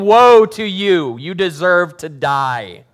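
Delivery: loud, slow shouted woe; mock screaming. Jesus screaming at the Pharisees at dinner, loud. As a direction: yell it like an angry prophet.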